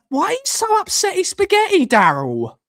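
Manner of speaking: very high-pitched mimicked question; mock upset questioning. Streamer imitating how the commenters sound, mock upset. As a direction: squeak it out in mock concern. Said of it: "They always sound like".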